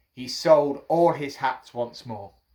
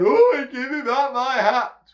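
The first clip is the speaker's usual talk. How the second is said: high, loud, slower monkey wail; mimicking monkeys; 9 semitones higher, 7 dB louder, slower than usual. A narrator's reading. Monkeys copying the hatter from above, squealed mimicry. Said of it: "they're copying me".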